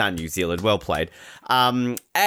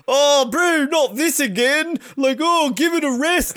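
mock fed-up groan of words, pitched high; exasperated brush-off, imitated high and loud; Nico; 13 semitones higher, 3 dB louder than usual. Skier brushing off interviewer's claim, mock exasperated. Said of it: "basically telling her to shove it".

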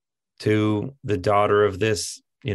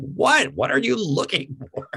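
much higher, stunned "what?"; incredulous; 12 semitones higher than usual. Retold or imitated. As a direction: throw the question back in disbelief. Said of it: "And I'm like".

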